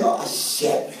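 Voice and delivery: low, hushed curse of dread; muttered dismay. Retold or imitated. Comedian recalls dreading his brother's church wedding, muttered dismay. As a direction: curse under your breath, low and soft.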